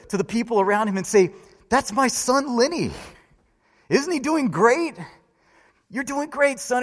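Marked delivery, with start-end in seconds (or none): 1.7-6.8 raised, eager cheer from the stands; proud cheering father. Retold or imitated. Father in the stands bragging to neighbours, excited cheer. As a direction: call out proudly to the crowd about your son. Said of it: "continued to cheer wildly".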